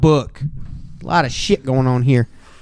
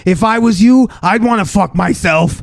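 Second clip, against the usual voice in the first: hammed-up, higher gym bro praise; playful over-the-top bro hype; 6 semitones higher than usual. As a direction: gush at your buddy like a gym bro.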